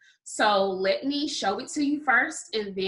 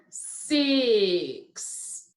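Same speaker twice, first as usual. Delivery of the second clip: SIX stretched out, vowel held long; said slowly. Shown as a demonstration. Teacher giving the next word, SIX said slowly. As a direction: draw SIX out so each sound is heard. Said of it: "I will say a word slowly".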